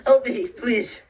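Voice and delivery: slow, high, loud plea for help; desperate shout. Acted. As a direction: shout yourself raw begging. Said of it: "I shout till my throat is raw".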